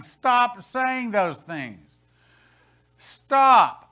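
loud, slow, furious shout; aggressive demand. Retold or imitated. Angry congregants shouting down the preacher, loud and furious. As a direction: bark stop with rising anger. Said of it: "They were aggressively saying".

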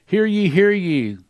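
slow, loud, high proclamation; town crier. Acted. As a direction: call it out like a herald. Angel's proclamation read aloud.

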